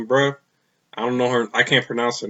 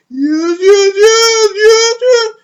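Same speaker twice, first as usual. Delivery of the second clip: much higher and louder, a strained crying-out yes; Paul Bearer voice; 10 dB louder, much slower than usual. Retold or imitated. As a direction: impersonate Paul Bearer, wailing each yes. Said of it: "Paul Bearer had the".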